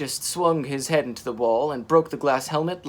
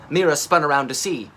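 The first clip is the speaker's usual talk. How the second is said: usual speaking voice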